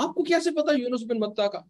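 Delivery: astonished question. Retold or imitated. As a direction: ask as if amazed they could know. Acts out Addas's astonished question. (हैरानी भरा सवाल)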